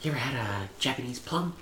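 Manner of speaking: hushed, creepy neighbor murmur; a creepy neighbor softly offering fruit; creepy whisper. Retold or imitated. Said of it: "he's whispering real creepy to me".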